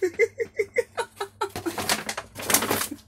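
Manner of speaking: long, very high giggle, falling away; high laughing fit. Real, not acted. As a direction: laugh helplessly at your own blunder. Laughing at breaking her bag.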